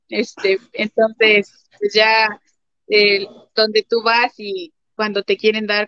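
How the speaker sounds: ordinary talk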